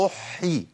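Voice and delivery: "أحي" with a held h into long ee; teacher's drawn-out "أحي"; doubled ha with kasra. Shown as a demonstration. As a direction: lean on the h in "أحي". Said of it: "التشديد بالكسر".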